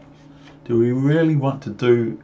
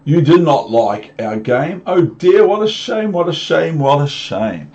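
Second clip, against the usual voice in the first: louder, higher mock lament; hammed-up sorry cat; 5 semitones higher, 5 dB louder than usual. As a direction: wail what a shame theatrically. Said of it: "said the cat".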